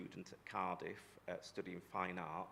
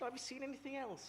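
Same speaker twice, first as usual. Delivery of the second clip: put-on eager young boy. Boy asking Uncle Dennis.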